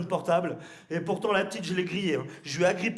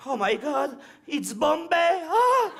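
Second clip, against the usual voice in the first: excited; 8 semitones higher, 5 dB louder, much slower than usual. Acted. Tourists amazed at the estate.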